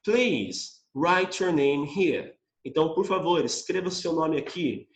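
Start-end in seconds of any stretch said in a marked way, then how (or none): 0.0-2.3 slow, careful English reading; slow read-aloud. Shown as a demonstration. Teacher reading the sentence slowly. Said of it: "E a última frase".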